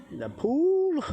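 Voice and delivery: high, drawn-out singsong announcement; showy reveal. Real, not acted. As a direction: present it proudly, pitch held way up. Showing off the pool on camera.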